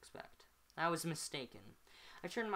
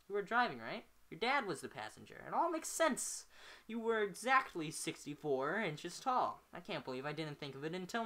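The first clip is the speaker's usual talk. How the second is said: perversely excited; 4 semitones higher than usual. Acted. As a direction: crow it like a prize-winning answer. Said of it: "He sounds perversely excited".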